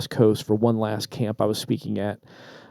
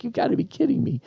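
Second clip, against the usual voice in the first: incredulous groan; 11 semitones higher, 6 dB quieter than usual. Retold or imitated. Irritated by chatty seatmate.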